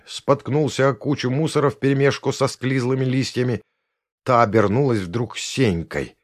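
plain reading voice

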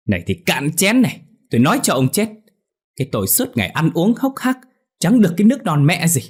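high, loud raging scolding; furious wife. A narrator's reading. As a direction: lash out at the drunk husband. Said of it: "bà Bành điên lắm rồi". (điên tiết chửi chồng)

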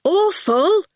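loud, high warning call; sternly. A narrator's reading. Fifi reins in Awful's outburst, sternly. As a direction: call the name in stern reproof. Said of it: "Fifi said sternly".

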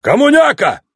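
high, sharp bark of a sergeant; barked insult. A narrator's reading. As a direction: bark it back like an army sergeant. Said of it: "рявкнул сержант".

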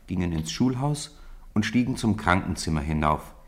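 normal talking voice

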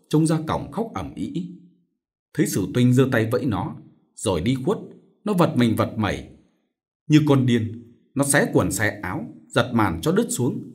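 ordinary delivery, nothing marked

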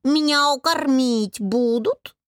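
slow, drawn-out cat's question; unperturbed cat. A narrator's reading. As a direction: meow it lazily as a hungry cat. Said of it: "напомнил о себе невозмутимо сидящий на тумбочке кот". (невозмутимый кот, протяжно)

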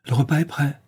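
calling out. A narrator's reading. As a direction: call lightly towards a closed door. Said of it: "lance son ami vers la porte close".

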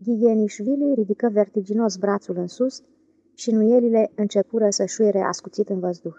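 ordinary delivery

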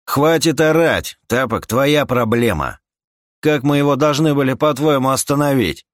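loud, high-pitched rebuke; irritated companion. Acted. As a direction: snap back at the yelling man. (раздражённая громкая отповедь)

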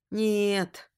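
plain reading voice

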